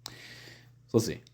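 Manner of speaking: ordinary talk, nothing marked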